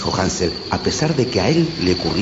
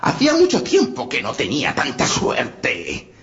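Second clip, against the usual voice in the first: witch voice; 6 semitones higher than usual. Acted. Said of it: "exclamó la bruja".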